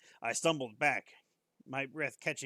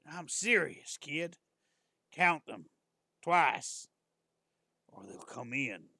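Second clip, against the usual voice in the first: put-on lightly southern old man, insisting; a hint of a Southern drawl, pressing the point; 5 dB louder, slower than usual. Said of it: "old man have a southern accent".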